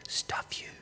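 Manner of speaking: silent thought voiced as a whisper; under the breath. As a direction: mouth off very softly, as if only in your head. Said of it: "I didn't say this out loud".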